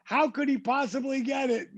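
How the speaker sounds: high-pitched pleading reasoning; drawn-out, high acted rationalizing; self-justifying protest. Arguing with himself over telling.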